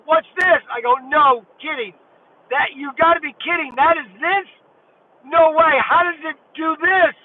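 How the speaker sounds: retold astonishment. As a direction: blurt out your disbelief at the toy. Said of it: "I almost lost it then".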